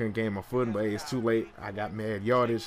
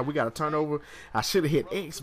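normal talking voice

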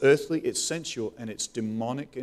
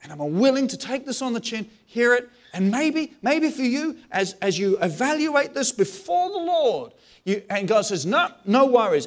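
higher, louder, animated; high-pitched, louder passionate appeal; excited preaching; 8 semitones higher, 6 dB louder than usual. Real, not acted. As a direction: lift the voice with conviction.

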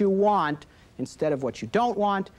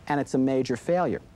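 normal talking voice

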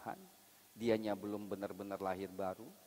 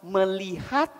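preaching at full force; 11 semitones higher, 12 dB louder than usual. Acted. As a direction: hammer the point home from the pulpit.

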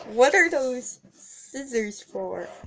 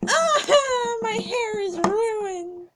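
high, slow mock-crying lament; mock wail; 10 semitones higher, slower than usual. Pony mock-mourning her ruined hair, high and slow.

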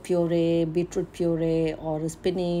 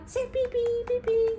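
very high, playful beep-beep; car horn imitation. Mother showing baby the car, playful horn noises. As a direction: squeak out a toy car horn.

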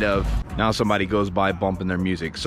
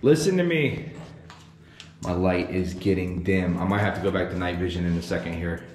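hushed; 6 dB quieter than usual. Real, not acted. Ghost hunt, speaking low in dark.

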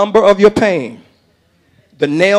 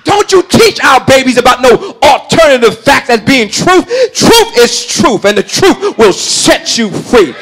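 full-force preaching, building higher and louder; fervent preaching; 8 semitones higher, 7 dB louder than usual. Real, not acted. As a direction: drive the congregation toward a shouted climax. Sermon builds to a climax.

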